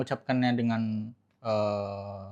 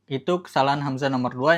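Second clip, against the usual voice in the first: ordinary talk, nothing marked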